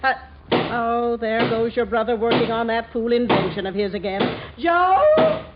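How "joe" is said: loud call to the next room. Acted. Mother calling her son over his hammering, loud call. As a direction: call up to the son across the house.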